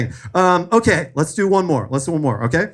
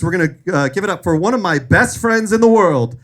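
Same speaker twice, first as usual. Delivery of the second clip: normal talking voice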